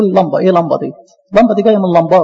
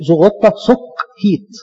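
زغطة سك هيت drawn out slowly, word by word; slow spelled-out words; much slower than usual. Shown as a demonstration. Teacher tracing the word's origin: slow and careful. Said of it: "أصلها قبطي".

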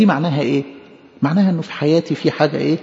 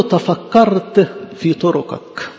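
slow solemn recitation; much slower than usual. Acted. Quoting the psalm verse, drawn out.

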